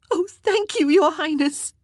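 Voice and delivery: high, loud burst of thanks; relieved girl crying thanks to the queen, high and loud; cried out in relief. A narrator's reading. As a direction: cry out your thanks. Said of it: "Mary cried".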